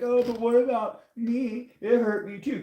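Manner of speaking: high, slowed self-pitying narcissist voice; mocking narcissist. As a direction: play the wounded narcissist, mockingly. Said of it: "That narcissist trying to be like".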